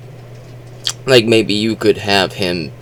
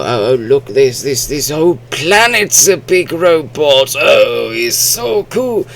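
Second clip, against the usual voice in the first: excited, higher mock gushing; awed moviegoer; 6 semitones higher than usual. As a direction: gush like a wowed fan at a dumb blockbuster. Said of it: "You go like".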